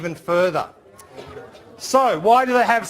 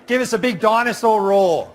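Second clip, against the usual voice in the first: ordinary talk, nothing marked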